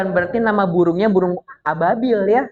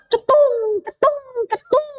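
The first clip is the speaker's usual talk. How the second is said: very high-pitched cartoon plinks; ringing mock impact noises; falling-stone sound effect. Storyteller drops the birds' stones for children, high sound effect. Imitates hot stones being dropped.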